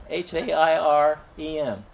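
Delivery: H A I R E M, each letter spoken separately and slowly; spelling harem out letter by letter for the pun; spelled out. Shown as a demonstration. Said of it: "I called her my harem".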